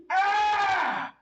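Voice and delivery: high shouted metal wail; metal scream. Retold or imitated. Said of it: "He's like this".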